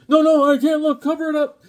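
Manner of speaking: loud, high-pitched panicked plea; over-the-top dread at full volume; hammed-up horror. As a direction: cry out like a horrified comic-book hero. Acts out the horrified reply in comic.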